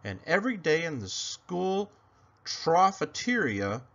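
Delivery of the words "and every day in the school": usual speaking voice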